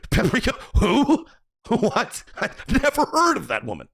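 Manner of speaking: high, stammering mock disbelief; feigned bewilderment. Streamer mocking an overblown reaction to gossip, high flustered stammer. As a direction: stumble over it, then burst out high in exaggerated confusion. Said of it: "You wouldn't go".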